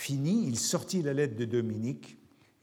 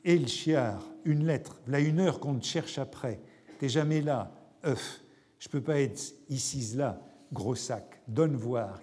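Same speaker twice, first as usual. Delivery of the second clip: normal talking voice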